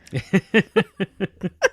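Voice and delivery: giggling at own joke; real laughter, rising; cracking up. Real, not acted. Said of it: "I'm laughing at my own titles".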